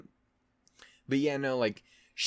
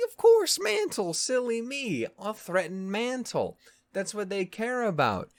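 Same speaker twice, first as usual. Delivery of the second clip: much higher, hammed-up mock epiphany; sarcastic realization; 11 semitones higher than usual. [name] mockingly realizes he can threaten Mantle, much higher. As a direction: gush it like a cartoon villain's lightbulb moment. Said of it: "he goes like".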